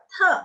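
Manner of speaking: "Te" short and high with a puff of air; aspirated T. Shown as a demonstration. Teacher showing T ada aspirasi, puffed "Te". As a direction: puff sharply on "Te". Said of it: "dibacanya ada aspirasi juga".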